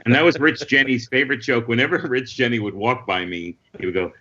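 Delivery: ordinary talk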